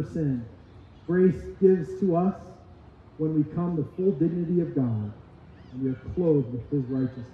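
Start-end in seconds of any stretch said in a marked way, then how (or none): none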